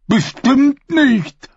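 very high, slow dim-witted voice; simple fool voice. A narrator's reading. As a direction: play a slow-witted ragged peasant. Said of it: "wagte einer der Narren zu widersprechen".

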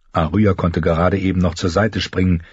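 ordinary delivery, nothing marked

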